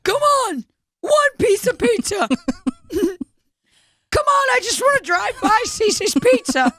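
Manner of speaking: high pleading inmate voice; begging whine. Pretend. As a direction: whine for a slice of pizza. Voicing prisoner begging pizza.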